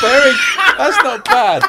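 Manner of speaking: high, loud, helpless with laughter; laughing shout. Real, not acted. Cracking up at the accent attempt.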